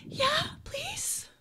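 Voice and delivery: tiny, high, breathy sniffle; high whispery child getting over tears; sniffly little girl. Acted. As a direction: squeak it out through sniffles. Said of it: "she sniffles like a".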